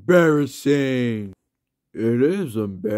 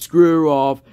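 shouted dismissal; 3 semitones higher, 7 dB louder than usual. Pretend. Telling off Fred haters.

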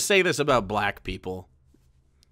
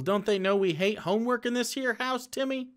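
folksy parent; 5 semitones higher, 5 dB quieter than usual. Pretend. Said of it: "Oh, hey, Timmy".

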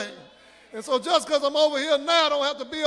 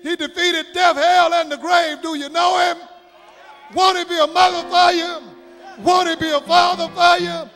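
climactic sermon shout, building louder in short rhythmic bursts; full-force preaching; 2 semitones higher, 7 dB louder than usual. Real, not acted. Preacher closing with praise of Jesus, asking do you know him, full-force preaching. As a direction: cry out each line of praise to rouse the room.

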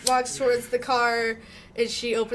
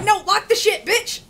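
laughing yell; 9 semitones higher, 4 dB louder than usual. Retold or imitated. Laughing retelling her yell.

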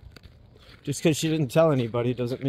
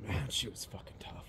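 breathy near-whisper, real grief; 7 semitones lower, 14 dB quieter than usual. Real, not acted. Remembering his sister, a real whispered tribute. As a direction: hush it out as if to himself. Quiet awe about dead sister.

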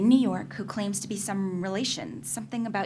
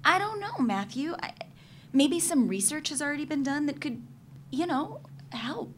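pleading; 6 semitones higher than usual. Acted. Pleading with Matthew about research.

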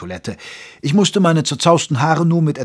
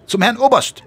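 high, loud sentry announcing guests; a young soldier calling out a formal report; 10 semitones higher, 3 dB louder than usual. Acted. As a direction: report the visitors crisply to the lieutenant. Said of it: "meldete der Posten".

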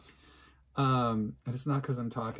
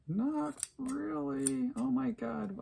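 much higher, drawn-out squeak; high slow mock answer; 13 semitones higher, much slower than usual. Real, not acted. Vlogger judges the haircut, high and drawn out. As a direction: stretch it high and slow, mocking yourself. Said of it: "self-mocking answer".

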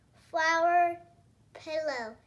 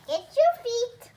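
loud, slow doll line; a child voicing her doll; 5 semitones higher, 6 dB louder than usual.

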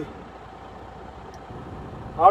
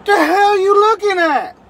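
high, loud, strained shout; yelled challenge; 8 semitones higher, 4 dB louder than usual. Real, not acted. Trucker yelling at someone staring at his rig, loud and strained. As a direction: yell it out the window, high and raw.

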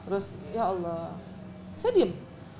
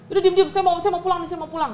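high, louder telling her to shut up; angry order; 8 semitones higher, 6 dB louder, faster than usual. Retold or imitated. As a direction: cut her off angrily. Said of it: "Kiwil yang marah".

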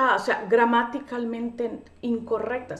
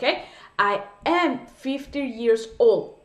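slow model phrase; much slower than usual. Shown as a demonstration. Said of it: "Lo correcto es decir".

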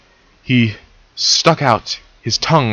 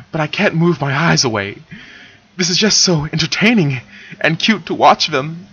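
ordinary delivery, nothing marked

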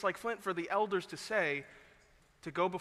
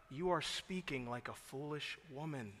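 tender rebuke; 3 semitones lower, 8 dB quieter than usual. Retold or imitated. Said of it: "I believe he said it in tenderness".

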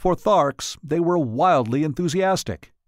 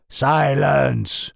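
a sudden commanding roar; 4 dB louder than usual. Acted. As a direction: bark the order to silence the council. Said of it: "roared Tal Haggis".

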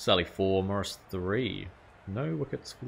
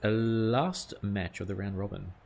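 commentator intro; 2 semitones higher, 5 dB louder than usual. Pretend. Gamer opening the match like a broadcaster, loud announcer voice. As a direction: boom it out like a TV commentator.

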